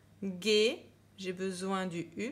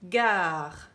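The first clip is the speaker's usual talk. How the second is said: gare dicté fort et net; dictée appuyée; 8 dB louder than usual. Shown as a demonstration. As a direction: dis "Gare" fort, comme une dictée. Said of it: "On entend, après le G, on entend A". (mot dicté d'une voix forte)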